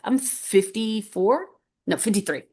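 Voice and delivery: ordinary talk, nothing marked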